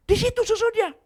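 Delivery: high, loud snapping order; boss yelling. Retold or imitated. Boss yells where to stack things, loud and high. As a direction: shout the order at her.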